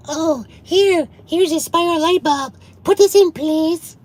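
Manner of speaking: very high, squeaky mock customer voice; hammed-up high-pitched customer plea, drawn out; imitating customer. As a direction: put on a silly high customer voice. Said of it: "and the customer's like".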